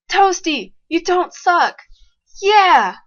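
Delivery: high and loud, praising; excited cheering. Real, not acted. Gamer praising Toasty after big play, high loud cheer. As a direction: cheer your Pokémon on.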